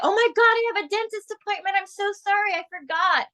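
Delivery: high-pitched, gushing apology, fast at first; flustered client. Retold or imitated. Coach mimics a client cancelling over a dentist appointment, high flustered apology. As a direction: rush the excuse out way up high, all apologies. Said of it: "That way your client can say".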